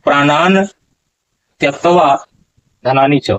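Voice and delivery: "प्राणान त्यक्त्वा" said as pranan, then tyaktva, no extra s; careful Sanskrit: "प्राणान त्यक्त्वा धनानि च"; correct pronunciation. Shown as a demonstration. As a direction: pause after "प्राणान" and then say "त्यक्त्वा" cleanly. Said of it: "यहाँ उच्चारण होगा".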